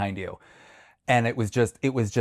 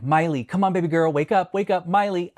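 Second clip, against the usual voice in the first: raised-pitch retold call to a dog; urgent coaxing; 7 semitones higher than usual. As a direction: coax a sleeping dog awake.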